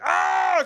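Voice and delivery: high, loud acted yell; cramp yell. Rider yelling at the woman ahead, high and loud. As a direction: yell out in sudden pain, high. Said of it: "from me yelling".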